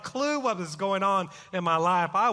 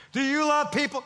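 retold yell; 7 semitones higher than usual. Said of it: "this guy's yelling on the bus".

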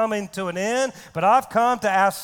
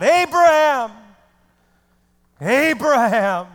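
shouted divine summons, first cry higher; God; 4 semitones higher, 7 dB louder, slower than usual. Acted. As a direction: call out a name like a voice from heaven. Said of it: "God shouts out".

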